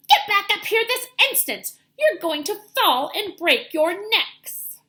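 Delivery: high, loud scolding; scolding mother. A narrator's reading. Mama bird scolding babies hanging from nest, loud scolding. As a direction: scold them sharply like a frightened mother.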